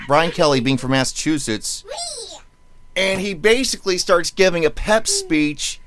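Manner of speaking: ordinary talk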